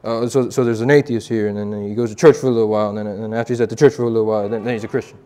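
low, fast mumbled ramble; Two Feathers, mumbling. Retold or imitated. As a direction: imitate a strange man rambling under his breath. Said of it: "he talked like this".

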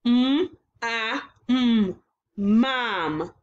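segmented sound by sound, then blended; mm, ah, mm, then mom; sound blending. Shown as a demonstration. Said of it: "let's blend these sounds together".

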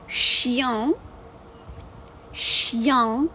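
"Chien" gliding from Й into a nasal "ян"; French nasal. Shown as a demonstration. Teacher demonstrates "Chien" to live viewers. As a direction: say "Chien" sliding through Y into the nose. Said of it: "Бачите, як я кажу?".

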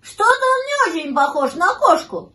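high, loud puppy voice; high-pitched doubtful pup; doubting puppy. A narrator's reading. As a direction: voice a little puppy having doubts. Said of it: "засомневался щенок".

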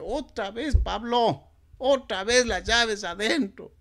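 exasperated self-reproach, high and drawn out; scolding himself. Retold or imitated. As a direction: berate yourself for locking the keys in again. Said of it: "me empiezo a insultar".

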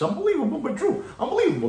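mock-amazed high refrain; high catchphrase. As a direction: toss it up high in disbelief.

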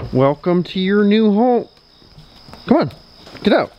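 high, rising pet-talk voice; sing-song welcome to a dog; excited dog talk. Real, not acted. Owner welcomes dog to new home, excited dog talk.

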